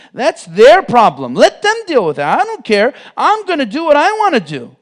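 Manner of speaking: mock carefree brag, loud; defiant I-don't-care voice. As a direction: toss it off like nobody's opinion matters. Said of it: "Do people talk like this?".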